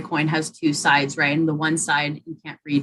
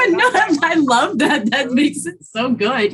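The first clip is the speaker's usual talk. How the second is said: high, loud laughing reassurance; 10 semitones higher, 6 dB louder, slower than usual. Real, not acted. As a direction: laugh while reassuring her. Laughing reassurance after the poem.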